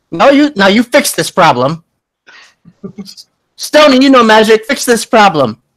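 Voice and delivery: bossy gnome mother; mom voice. Acted. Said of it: "with the mom voice".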